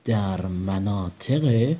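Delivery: very slowly drawn out for repeating; very slow prompt. Shown as a demonstration. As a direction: stretch each syllable for the child. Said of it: "بگید". (بسیار کشیده و آهسته برای تکرار)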